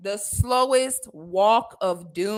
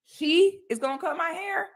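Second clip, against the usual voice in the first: doubting customer; 6 semitones higher than usual. Retold or imitated. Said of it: "He literally says that".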